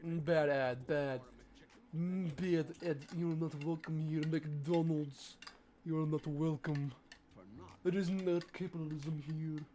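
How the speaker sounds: mock Russian accent; hammed-up Russian accent. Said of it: "I can do Russian accent".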